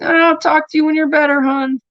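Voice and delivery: high-pitched mock mom voice; imitated dismissive mother. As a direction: mimic a mother putting off the call. Imitates mother brushing her off.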